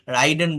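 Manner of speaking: রাইডেন with an "ai" as in ride; common mispronunciation. Shown as a demonstration. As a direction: say রাইডেন with a gliding first vowel. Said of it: "অনেকে এটা রাইডেন বলে".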